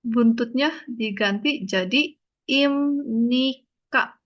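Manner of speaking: "imnika" said slowly, each syllable clear; slow, drawn-out imnika. Shown as a demonstration. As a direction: spell out imnika slowly for students. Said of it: "kalau pertanyaan". (ucapkan "imnika" pelan dan jelas)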